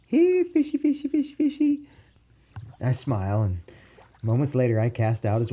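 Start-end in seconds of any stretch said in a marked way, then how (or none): 0.1-1.8 very high sing-song calling; fish call. Acted. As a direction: coax the fish in a squeaky, held high pitch. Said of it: "gives out her proven fish call".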